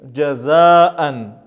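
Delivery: "Джазаан" with a long a, then a separate "ан"; hamza as alif. Shown as a demonstration. Teacher shows hamza read as alif, "Джазаан" with drawn-out a. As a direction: stretch the middle of "Джазаан", then restart the vowel. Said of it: "читается как элиф".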